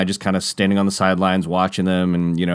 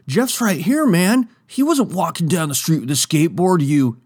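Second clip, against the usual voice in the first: high, pushy bro accusation; hammed-up skater telling him off; mock angry skater; 9 semitones higher than usual. Skaters exposing the lie, high mock anger.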